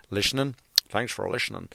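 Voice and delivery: quiet, puzzled "lishening" for "listening"; querying own slip. Real, not acted. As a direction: softly echo "listening" as "lishening".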